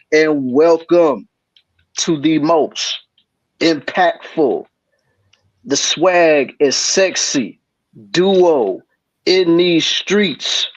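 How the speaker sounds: slow, drawn-out hype announcer; milk each word like a show host; hype host intro. Acted. Hyped host intro, slowed.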